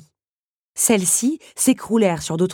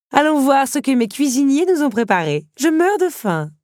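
high, loud countess, eager for the buffet; exuberant countess; 10 semitones higher, 7 dB louder than usual. Acted. Countess leading her guest to the buffet, exuberant. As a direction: play a hungry, theatrical hostess at full voice.

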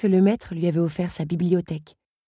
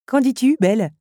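higher, louder, falling on Belle; taunting challenge; 8 semitones higher, 5 dB louder than usual. Acted. Henri challenges Belle, taunting. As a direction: dare her to answer.

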